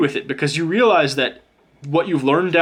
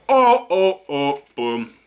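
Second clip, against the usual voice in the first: excited oh boom; slower than usual. Real, not acted. Card reveal delights the reader.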